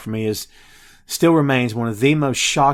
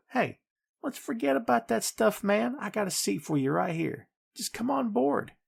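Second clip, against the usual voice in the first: higher, softer, coaxing mock offer; casual mock pitch; 8 semitones higher, 6 dB quieter than usual. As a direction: play a chummy recruiter making light of it, pitched up. Voices Vader's offer as casual pitch.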